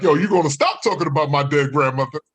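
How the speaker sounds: hammed-up indignant challenge; mock outrage. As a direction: act offended on grandma's behalf. Acted protest to insulting boyfriend.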